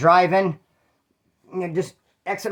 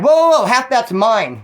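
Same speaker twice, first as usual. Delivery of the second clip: louder, slowed whoa whoa whoa; protesting; 6 semitones higher, 7 dB louder, slower than usual. Retold or imitated. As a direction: stop him with a loud drawn-out protest. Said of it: "Art's like".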